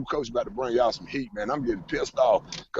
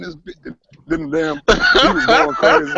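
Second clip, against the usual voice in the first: excited; 9 semitones higher, 8 dB louder than usual. Real, not acted. Excited retelling.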